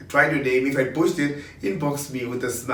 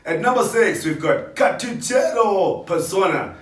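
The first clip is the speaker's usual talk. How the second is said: higher, punchy radio DJ announcing; radio presenter voice; 7 semitones higher, 4 dB louder than usual. Shown as a demonstration. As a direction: announce it like a hyped radio host. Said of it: "I'm gonna speak like a radio presenter".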